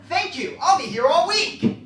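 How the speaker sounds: high, loud hammed-up comic sign-off; broke comedian impression. Comedian thanking his parents, high and loud. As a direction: play the struggling comic thanking the crowd. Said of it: "We got an impression".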